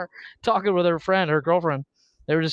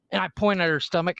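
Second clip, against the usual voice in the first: normal talking voice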